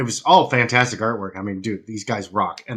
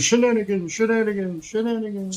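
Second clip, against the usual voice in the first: echo effect; 9 semitones higher than usual. Acted. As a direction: let the word echo away into the distance. Echo on show opener.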